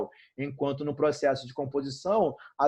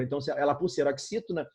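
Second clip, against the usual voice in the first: normal talking voice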